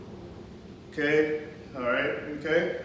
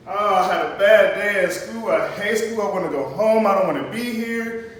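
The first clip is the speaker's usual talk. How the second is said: louder, mildly hammed-up student gripe; mock anger; 4 dB louder than usual. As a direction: act out a fed-up pupil griping about school. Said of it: "His tone is probably gonna be anger".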